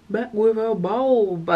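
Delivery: BULB stretched long, slow and playful; drawn-out reveal. Real, not acted. Showing viewers the new haircut, slow drawn-out BULB. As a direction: slowly relish the words, holding BULB.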